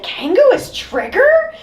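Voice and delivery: very high, overblown astonishment; mock disbelief. Critic mimicking the other character's reaction, hammed-up shock. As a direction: echo it as a shocked, squeaky question. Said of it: "the other person is like".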